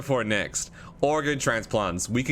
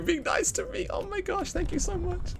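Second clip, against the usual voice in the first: relieved squeal; 4 dB quieter than usual. Real, not acted. Relieved at surviving vote.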